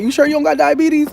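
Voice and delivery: high, incredulous mock doctor voice; squeaky doubting imitation of the doctor. Patient mocking the doctor's question in a high voice. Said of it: "nobody be like".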